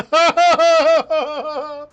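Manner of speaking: high, loud words through laughter; laughing while talking. Real, not acted. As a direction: speak while still cracking up. Laughing at clip.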